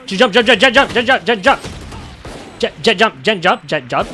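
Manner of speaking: shouting urgent warnings, fast repeats; urgent callout. Real, not acted. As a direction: yell it out to teammates. Calling out Jett's jump in game.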